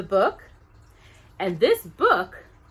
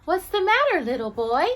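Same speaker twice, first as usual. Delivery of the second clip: high, gentle little angel question; tiny angel voice; 7 semitones higher, 4 dB louder than usual. Acted. Tiny angel asking a sad boy, high voiced. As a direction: ask like a tiny caring angel.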